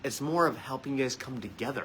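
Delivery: usual speaking voice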